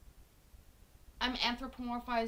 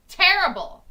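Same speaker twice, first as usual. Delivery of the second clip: loud, higher-pitched exclamation; mock outburst; 7 semitones higher, 15 dB louder than usual. Streamer condemning her own accent method, loud mock outburst.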